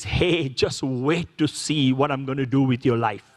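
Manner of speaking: a hint of a low, calm God. God answering the preacher, lightly voiced and low. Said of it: "God says".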